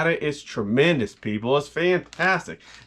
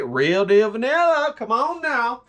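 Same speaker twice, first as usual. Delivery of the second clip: ordinary delivery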